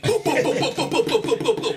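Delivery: rapid boop sounds like a toy gun firing; gun noise. Retold or imitated. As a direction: mimic an automatic BB gun going off.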